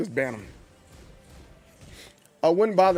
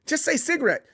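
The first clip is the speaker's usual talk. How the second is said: much higher, pleading "stop saying that"; exasperated protest; 9 semitones higher than usual. Retold or imitated. American friend begging Brit to stop saying it, high pitch. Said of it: "You're like".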